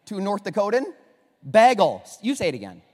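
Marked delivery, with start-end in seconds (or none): 1.5-2.0 Bagel loud, short a; Bagel said his way. Shown as a demonstration. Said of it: "Two South Dakota".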